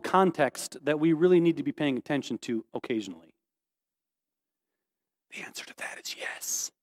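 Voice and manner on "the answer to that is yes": whispered aside; stage whisper. Real, not acted. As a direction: let them in on the answer. Prompting congregation's answer.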